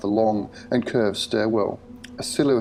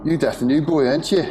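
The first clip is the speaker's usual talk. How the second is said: put-on loud, high-pitched thick Scouse accent; booming Scouse; 6 semitones higher, 5 dB louder than usual. A narrator's reading. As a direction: boom it out in broad Scouse. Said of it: "a voice boomed out, beaten by a thick Scouse accent".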